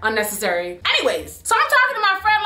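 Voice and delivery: usual speaking voice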